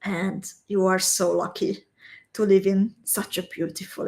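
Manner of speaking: ordinary delivery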